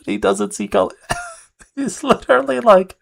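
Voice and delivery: high, laughing through words; cracking up while talking. Real, not acted. Laughing at the clip.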